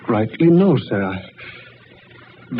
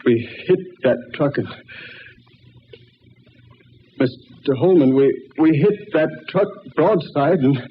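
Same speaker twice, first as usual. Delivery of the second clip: halting, broken-up speech after a crash; faltering, catching breath between phrases; dazed and shaken; 3 semitones higher, 3 dB quieter than usual. Acted. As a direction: stammer it out in shock.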